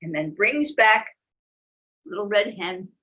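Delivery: lively storybook reading; animated picture-book narration; reading to children. A narrator's reading. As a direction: tell it to little ones.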